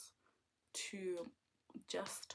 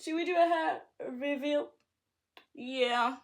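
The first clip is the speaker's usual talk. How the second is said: excited; 6 semitones higher, 9 dB louder than usual. Acted.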